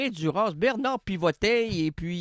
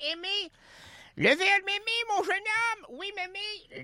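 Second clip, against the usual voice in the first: put-on old granny voice; 9 semitones higher, 3 dB quieter than usual. Grandma and boy voices.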